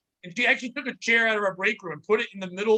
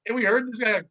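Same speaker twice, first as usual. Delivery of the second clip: ordinary talk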